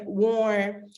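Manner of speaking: ordinary delivery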